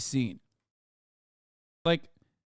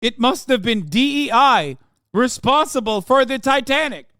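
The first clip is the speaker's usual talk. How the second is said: mocking quote; 6 semitones higher, 7 dB louder than usual. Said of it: "when we're talking about failure".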